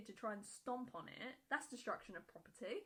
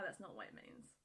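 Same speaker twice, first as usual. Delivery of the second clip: normal talking voice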